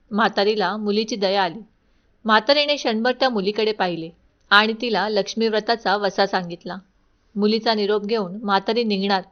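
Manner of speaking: plain reading voice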